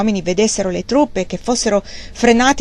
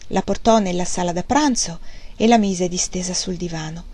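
ordinary delivery

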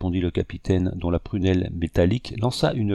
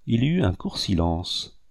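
usual speaking voice

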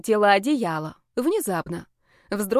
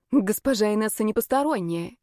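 ordinary delivery

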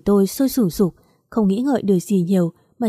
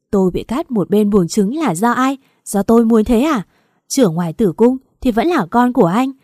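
screaming; 5 dB louder than usual. Acted. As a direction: hurl every grievance at him, screaming. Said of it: "gào lên".

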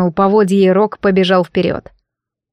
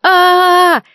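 long high falling scream; terrified scream; 10 semitones higher, 4 dB louder than usual. Acted. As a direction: scream in terror as you dive. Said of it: "кто кричал громче".

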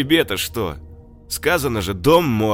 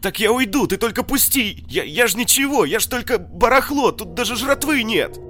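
high, loud panicked begging; hysterical pleading; 9 dB louder than usual. Acted. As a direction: plead for life, frantic. Мародёр в ужасе оправдывается. (истеричная мольба)

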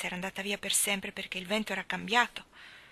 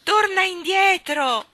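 slow, high, full-voiced call; desperate shout; 11 semitones higher, 12 dB louder, slower than usual. A narrator's reading. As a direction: beg her back at the top of your voice.

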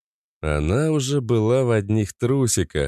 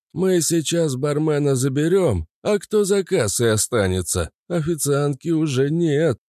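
put-on old man voice; 6 semitones higher than usual. Grandpa character continues.

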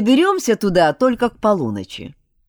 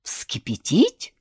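slow, drawn-out aghast echo of the word; a mildly horrified spirit, slow and stunned; horrified question; slower than usual. A narrator's reading. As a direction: repeat it slowly in disbelief. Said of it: "ужаснулся Али".

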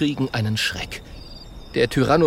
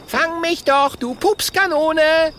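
high, loud cheeky taunt; calling out; 4 dB louder, slower than usual. Acted. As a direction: shout it mockingly.

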